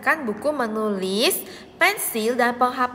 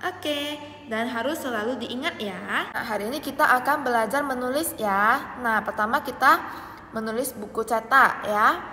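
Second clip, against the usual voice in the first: ordinary talk, nothing marked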